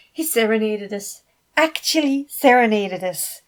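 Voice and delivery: loud, thrilled gushing; a giddy fan dancing around. A narrator's reading. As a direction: gush like you can't contain your joy. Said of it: "Felix gushed".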